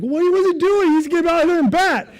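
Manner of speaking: high, loud baffled protest; exasperated outburst. Retold or imitated. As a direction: sputter in disbelief. Dad frets to wife.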